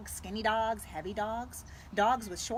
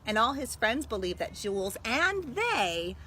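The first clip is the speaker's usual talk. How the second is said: louder, animated read-aloud; story reading; 4 dB louder than usual. Acted. Librarian reading the story's ending to students. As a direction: tell it with full storyteller energy. Reads picture book aloud.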